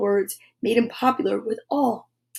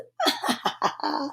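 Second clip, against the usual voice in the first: breathy, hushed witch's cackle; a wicked little triumphant laugh; evil cackle; 3 semitones higher, 10 dB quieter than usual. Acted. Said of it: "she cackled".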